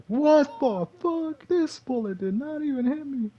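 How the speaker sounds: very high, slow disbelieving squeal; shocked. Retold or imitated. Gamer shocked that a bullet missed him, high and slow. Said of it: "but then I was like".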